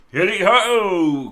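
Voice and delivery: long loud whooping hail; jolly cheer. A narrator's reading. Fezziwig leaping from his desk, loud drawn-out jolly whoop. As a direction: whoop it out like a merry squire.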